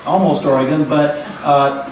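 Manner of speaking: ordinary talk, nothing marked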